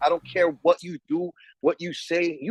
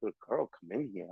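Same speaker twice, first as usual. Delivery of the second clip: much softer, quick mumbled repeat; mocking undertone repeat; 6 semitones lower, 13 dB quieter, faster than usual. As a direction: toss it off under your breath, fast, mocking. Repeating his question mockingly.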